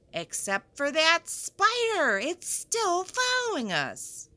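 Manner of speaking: high, mock-frightened whisper of alarm. Teacher pointing out a spider to the class, mock-scared. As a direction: pretend to spot something creepy.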